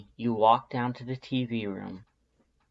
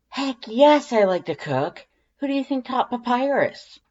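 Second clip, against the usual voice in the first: much higher and louder, indignant tomboy voice; indignant Undyne; 13 semitones higher, 7 dB louder than usual. A narrator's reading. Undyne insisting she taught Papyrus to cook, loud and indignant. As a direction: bristle like your pride is insulted. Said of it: "she's saying indignantly".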